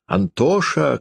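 put-on high-pitched call to a child; lightly acted mother's cry. A narrator's reading. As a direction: call the name high, modest volume. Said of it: "кричала она".

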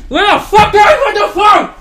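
high, loud, exasperated shout; frantic yell. Real, not acted. Can't find the phone to call Sully, a frantic yell. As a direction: yell it while hunting for the phone.

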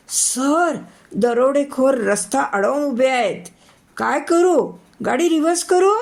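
high, alarmed cry to the boss; panicked driver. Acted. Scared driver asks his boss about reversing, high alarmed call. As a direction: driver yelling in fright at the roadblock. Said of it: "एवढ्यात केशवही ओरडला".

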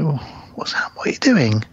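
whispered uneasy question; nervous whisper. Retold or imitated. Host retells the passenger asking his friend in a nervous whisper. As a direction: whisper it as if scared of what's coming.

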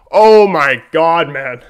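usual speaking voice

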